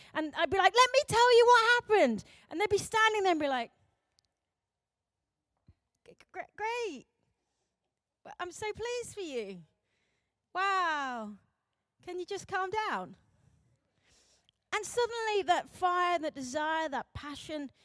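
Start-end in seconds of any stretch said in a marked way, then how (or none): 0.7-2.2 high, loud excited outburst; hammed-up eagerness, spill it out; bursting to share. Teenager sharing an experience with friends, loud and thrilled. 6.1-13.2 high, soft, hesitant mock politeness; awkwardly unimpressed. Friends standing there facing excited teenager, thinly polite.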